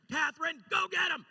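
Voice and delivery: high, loud cheering shout; fan shout. Retold or imitated. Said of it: "but I was like".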